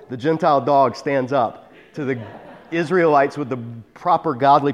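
usual speaking voice

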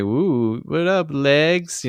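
drawn-out "what up, legs"; lightly voiced leer; slow mock catcall. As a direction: catcall like a creepy teen. Turtles ogling April.

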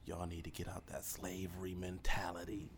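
soft, breathy aside to the listeners; hushed aside. Real, not acted. Talking to Southern listeners, hushed urging. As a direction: lean in and say it low.

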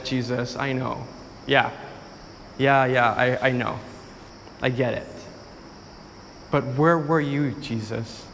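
a grieving sister answering Jesus, half resigned; Martha. Acted. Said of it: "And Martha responds".

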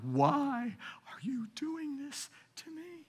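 high, breathy whisper to God; slow whispered cry of confusion; whispered plea. Retold or imitated. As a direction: whisper it like a frightened child.

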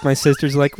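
ordinary talk

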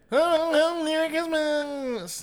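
high, slow elf greeting; Christmas elf. Acted. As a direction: stretch out Merry Christmas like a tiny elf. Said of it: "if Aziz Ansari was a Christmas elf".

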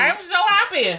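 high gloating glee; gloat, delighted; gleeful teasing. Real, not acted. Teasing friend over hot sauce.

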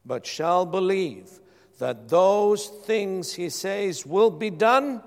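loud bursts on key words, measured pauses; emphatic scripture reading. Real, not acted. Preacher quoting Jesus to the congregation, emphatic scripture proclamation. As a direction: proclaim the verse from the pulpit.

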